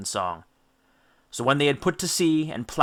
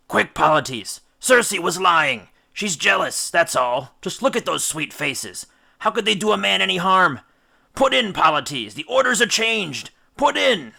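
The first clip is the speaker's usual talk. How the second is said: higher and louder, climbing to a desperate yell; frantic shout; 7 semitones higher, 5 dB louder than usual. Acted. Bewitched captain yells at his rower to steer ashore, frantic. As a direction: scream the new orders like a man possessed. Odysseus bewitched, begging to land.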